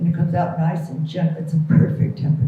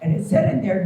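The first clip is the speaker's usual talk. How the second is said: ordinary delivery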